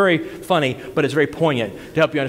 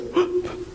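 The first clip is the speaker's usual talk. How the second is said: short acted gasp of amazement; astonished gasp; 5 dB quieter than usual. Woman looks at minister after his amen, astonished gasp. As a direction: draw a sudden stunned breath.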